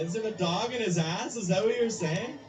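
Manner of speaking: ordinary talk, nothing marked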